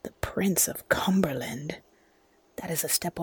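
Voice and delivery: hushed whisper under breath; whispered aside. Acted. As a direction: breathe it to yourself. Macbeth mutters aside alone.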